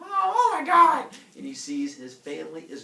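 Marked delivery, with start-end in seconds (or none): 0.0-1.1 very loud, high, drawn-out shriek; horrified scream. Retold or imitated. Heracles finding monsters at home, long horrified yell. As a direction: scream it in comic shock. Discovers monsters in his house.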